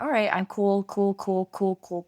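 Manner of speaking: mock keeping it together; talking self calm. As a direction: insist you're coping, over and over. Retelling holding it in.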